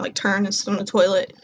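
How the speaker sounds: ordinary delivery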